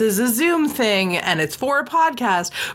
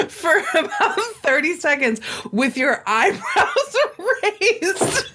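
laughing while talking; 8 semitones higher, slower than usual. Real, not acted. Laughing while retelling Brandon's judgment.

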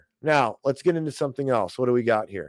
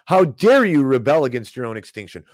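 loud sarcastic scolding; hammed-up telling-off; mock outrage; 7 dB louder than usual. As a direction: scold them loudly in pretend indignation.

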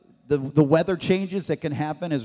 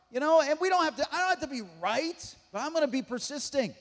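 normal talking voice

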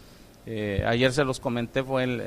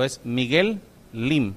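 "Miguel Lim" spoken slowly, with a clear gap between Miguel and Lim; slow, careful correction of the name; name it slowly and deliberately so nobody mishears; slower than usual. Shown as a demonstration. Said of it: "déjenme decirlo más despacio".

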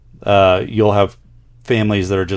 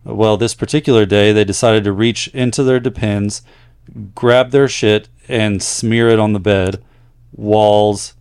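louder, steady read-aloud voice; letter-reading voice; 5 dB louder than usual. Acted. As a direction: read the listener's story out to the audience. Reads a listener's letter aloud.

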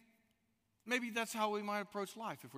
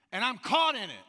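anguished cry; 6 semitones higher, 11 dB louder than usual. Acted. Voicing Koheleth's anguished complaint.

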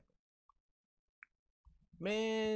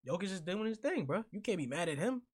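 ordinary talk, nothing marked

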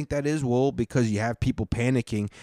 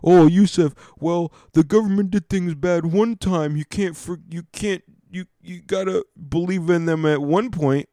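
higher-pitched voice of an objector; mock protester, pitched up; objecting listener; 5 semitones higher, 3 dB louder than usual. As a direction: push back in a raised, put-on voice. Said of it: "you're gonna say".

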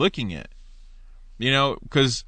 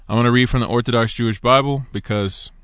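normal talking voice

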